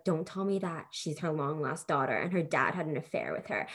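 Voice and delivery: a hint of a knowing mother; mom's voice. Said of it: "And she was like".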